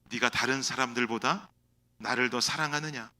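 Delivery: low, soft searching question; gentle question. Acted. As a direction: ask it quietly and tenderly. Lord asks Peter quietly. (낮고 부드럽게 묻는 주님)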